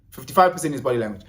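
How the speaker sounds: quick throwaway repeat; fast. Shown as a demonstration. Repeating the fact to viewers, rushed. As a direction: rattle it off fast. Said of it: "repeat faster".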